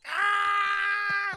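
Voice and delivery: loud high mock scream of a burning man. Friend mimics the burning man's scream, loud mock scream. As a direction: scream like the guy on fire.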